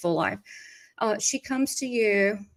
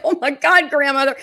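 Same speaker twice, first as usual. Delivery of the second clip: higher, louder laughing protest; mock scandalized; 4 semitones higher, 4 dB louder than usual. Medium retells scolding the cheeky grandmother, mock scandalized. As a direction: retell being playfully appalled.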